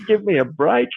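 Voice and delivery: high-pitched scornful dismissal; playfully fed up; mock exasperation. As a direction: scoff at the phony gushing. Scoffing at the gushing rapport act.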